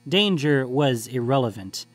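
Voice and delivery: usual speaking voice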